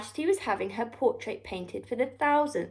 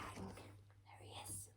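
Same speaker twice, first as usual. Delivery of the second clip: whisper; 30 dB quieter, slower than usual. Acted.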